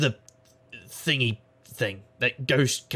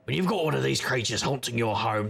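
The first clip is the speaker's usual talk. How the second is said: ordinary talk, nothing marked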